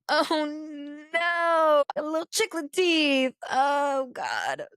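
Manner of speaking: high, drawn-out comic whine; mock horrified wail. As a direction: wail in exaggerated dismay at the sight. Retold horror at seeing his teeth.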